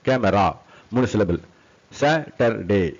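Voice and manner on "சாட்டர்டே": slow SA - TER - DAY in three beats; syllable by syllable. Shown as a demonstration. Teacher demonstrates three syllables, syllable by syllable. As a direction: separate each syllable of சாட்டர்டே.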